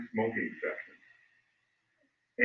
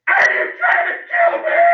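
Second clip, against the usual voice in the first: much higher, much louder shriek; retold shriek; 17 dB louder than usual. Sister screaming at a smoking businessman, high shriek. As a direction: scream it in his face. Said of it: "leans down and says".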